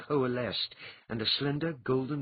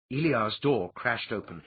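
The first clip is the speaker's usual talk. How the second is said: ordinary delivery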